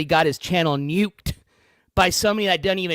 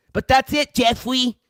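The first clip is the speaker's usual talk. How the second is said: mock Jeffrey voice; 5 semitones higher than usual. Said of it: "not all Jeffreys talk like that".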